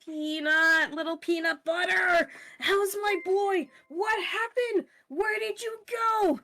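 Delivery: very high, drawn-out doting squeal; gushing pet talk. Acted. Owner hugging the lost dog, high gushing baby talk. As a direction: gush over your dog, voice way up.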